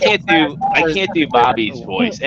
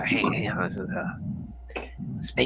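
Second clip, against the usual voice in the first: hushed raspy YouTuber voice; 4 semitones lower, 9 dB quieter, faster than usual. Retold or imitated. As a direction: rasp it out, barely voiced. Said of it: "I can definitely do Bolt Matrix's voice".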